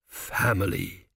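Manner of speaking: slow, low, wondering priest; softened. A narrator's reading. Priest stared at the king, voice softened. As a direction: let the bark melt away, slowly. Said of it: "his voice softened from its normal bark".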